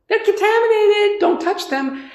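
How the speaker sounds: high singsong taunt, then a warning; mock kids' taunt. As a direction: tease like a schoolkid pointing. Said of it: "it was sort of similar".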